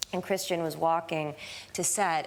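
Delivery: ordinary delivery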